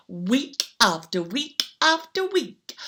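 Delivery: plain reading voice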